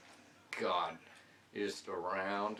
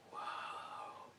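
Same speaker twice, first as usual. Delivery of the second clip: awed whisper; 9 dB quieter than usual. Retold or imitated. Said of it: "I was like".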